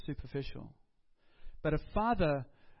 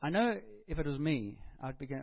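ordinary delivery

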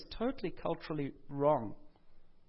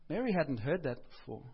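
ordinary talk

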